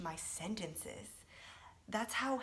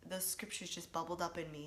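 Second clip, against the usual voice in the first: ordinary delivery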